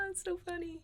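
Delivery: high giggly laugh into the words; amused laugh, quiet and high. Real, not acted. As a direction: giggle then say it, still laughing. Amused at filming on time.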